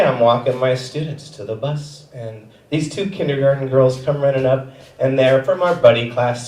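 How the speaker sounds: ordinary delivery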